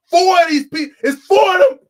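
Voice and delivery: loud, high-pitched indignation; exasperated outburst. Real, not acted. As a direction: hammer it home in loud disbelief. Exasperated rant about the film.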